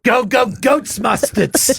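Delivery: cartoon New Yorker imitation; mocking fake New York. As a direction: mimic a hammy New York holler. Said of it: "No one talks that way".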